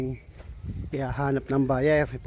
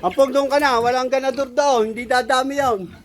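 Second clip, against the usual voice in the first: calling out; 8 semitones higher, 4 dB louder than usual. Real, not acted. Calling out to Ampong.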